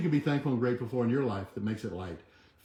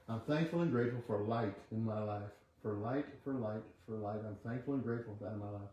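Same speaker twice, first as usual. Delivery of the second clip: quiet affirmation; 3 semitones lower, 8 dB quieter than usual. Acted. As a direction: murmur the affirmation like a meditation. Leads a chanted affirmation.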